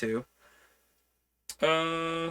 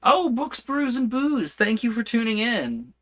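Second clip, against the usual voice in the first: high, loud delighted shout-out; excited greeting; 11 semitones higher, 4 dB louder than usual. Real, not acted. Streamer thrilled to greet a viewer joining the stream, excited high shout-out. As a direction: welcome them with a burst of joy.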